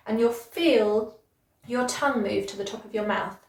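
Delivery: ordinary talk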